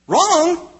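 high-pitched, drawn "Wrong"; emphatic rebuttal. Acted. Rebuts an imagined churchgoer's excuse.

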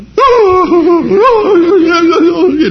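high, drawn-out mock wail; hammed-up child's crying wail. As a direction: wail like a kid upset over a bad grade. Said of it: "they went".

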